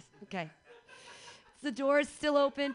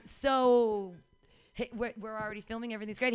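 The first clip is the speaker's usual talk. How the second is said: ordinary talk, nothing marked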